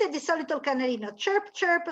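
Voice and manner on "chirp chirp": light chirps. Shown as a demonstration. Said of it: "sono quelli un po' più grandini".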